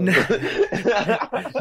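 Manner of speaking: a sudden high, loud laugh; high burst of laughter. Real, not acted. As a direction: crack up at the punchline. Laughing at the story's twist.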